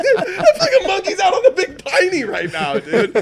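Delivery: high loud laughing disbelief; amazed laughing. Retold or imitated. Telling friends about the Big Piney monkey, high laughing amazement. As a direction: marvel at it, cracking up. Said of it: "I'm like".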